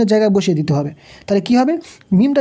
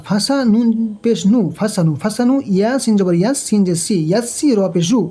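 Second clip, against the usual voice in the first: usual speaking voice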